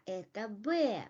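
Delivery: "это Б" as an answer, pitch falling on Б; falling statement intonation. Shown as a demonstration. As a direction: let "Б" drop to confirm. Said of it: "use falling intonations".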